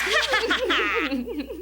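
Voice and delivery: high, scornful cackle; mocking laugh. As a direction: jeer at her. Stepsister mocking Cinderella.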